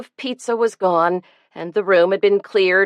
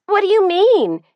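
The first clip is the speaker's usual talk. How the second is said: high, loud demanding challenge; 13 semitones higher, 5 dB louder than usual. A narrator's reading. Daughter challenging her father's ruling, loud and demanding. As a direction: throw the question back hard. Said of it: "demanded Elizabeth".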